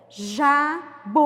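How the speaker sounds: JABUTICABA split into five separate, loud syllables. Shown as a demonstration. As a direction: count out "Jabuticaba" syllable by syllable with clear pauses. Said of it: "Vamos contar".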